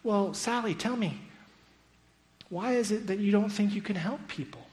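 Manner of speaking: mock-gentle questioning of a child; probing teacher. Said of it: "and the teacher says".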